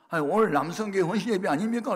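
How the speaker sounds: high, loud retold questioning; baffled protest. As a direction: protest in disbelief at being forgotten. Acted baffled protest to the forgetful pastor. (어이없다는 듯 높고 크게 따져 묻기)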